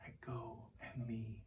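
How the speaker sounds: softly whispered "Ego eimi tophos tu kosmou"; whispered Greek. Acted. Jesus whispering to the listener, hushed Greek. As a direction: breathe the Greek words as a whisper. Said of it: "And he whispers to you".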